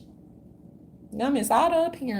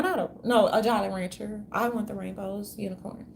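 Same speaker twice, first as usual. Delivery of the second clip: usual speaking voice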